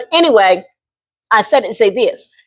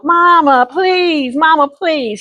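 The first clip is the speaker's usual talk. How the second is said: higher, begging voice to mama; pleading teen; 7 semitones higher, much slower than usual. Retold or imitated. Teen daughter begging her mother to allow modeling, higher pleading. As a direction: plead like a hopeful teenager.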